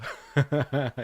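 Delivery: short amused laugh; a quick real laugh. Real, not acted. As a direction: laugh at your own joke. Laughs at own joke.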